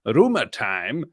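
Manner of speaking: slow, drawn-out announcer voice for Rumor Time; dramatic announcer. Shown as a demonstration. Host unveiling his new segment Rumor Time, dramatic announcer voice. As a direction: introduce the segment like a trailer voice. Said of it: "I'm gonna call Rumor Time".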